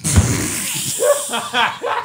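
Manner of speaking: wheezing high laugh; high breathless laugh. Real, not acted. Laughing at the joke about women, a high breathless wheeze. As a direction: laugh almost silent, air only.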